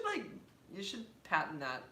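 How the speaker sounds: normal talking voice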